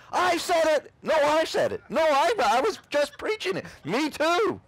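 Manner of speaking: high, loud competing shouts; each one claiming credit at once; mock squabbling pastors. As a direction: pastors in heaven bickering over credit, hammed-up high shouts.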